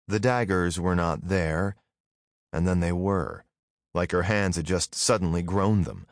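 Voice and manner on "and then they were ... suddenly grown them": usual speaking voice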